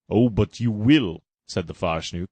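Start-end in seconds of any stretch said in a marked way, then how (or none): 0.1-1.2 menacing villain. Acted. Farshnook menacing.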